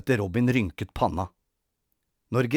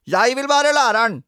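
very high, loud bratty wail; clamouring, insistent boy; naughty boy; 11 semitones higher, 8 dB louder than usual. A narrator's reading. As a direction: outdo the other child's demand, louder and higher. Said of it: "sa rampete Robin".

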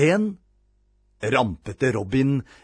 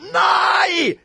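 high, loud, long scream; howled refusal; 6 dB louder than usual. A narrator's reading. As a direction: howl in protest. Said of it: "hylte rampete Robin".

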